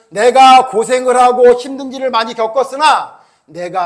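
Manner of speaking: Paul's earnest confession. Retold or imitated. Preacher speaks as Paul to the church, slow and earnest. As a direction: confess it slowly like the apostle.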